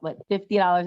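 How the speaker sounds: ordinary talk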